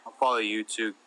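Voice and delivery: ordinary talk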